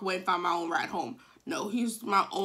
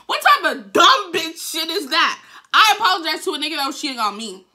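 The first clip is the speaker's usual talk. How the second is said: loud, raised-pitch exasperation; mad at herself; 7 semitones higher, 10 dB louder than usual. Real, not acted. Storyteller berating herself for apologizing to cheater, mad. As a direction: rant in disbelief at your own stupidity. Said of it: "I'm still mad to this day at myself".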